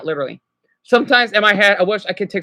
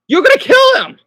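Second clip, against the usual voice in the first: incredulous outburst; 7 dB louder than usual. Real, not acted. Reacting to the too-soft parent.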